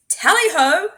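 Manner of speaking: high, loud hunting cry; queen rallying the hunt; shout. Acted. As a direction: call the hounds on. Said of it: "shouted the queen".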